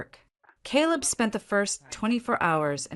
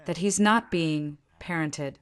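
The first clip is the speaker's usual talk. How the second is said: ordinary delivery, nothing marked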